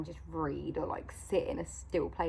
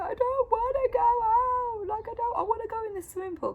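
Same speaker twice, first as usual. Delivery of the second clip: imitating a crying child; 6 dB louder than usual. Mum imitating her son crying about going out, high and whiny. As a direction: mimic a kid sobbing that he won't go. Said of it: "initially he cried".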